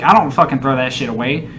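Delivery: ordinary delivery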